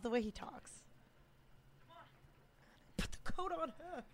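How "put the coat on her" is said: high, soft British movie-villain line; British accent. Retold or imitated. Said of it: "So British".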